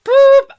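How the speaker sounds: high, loud "boop" whistle; short piercing mock whistle blast; whistle imitation. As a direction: toot like a referee's whistle.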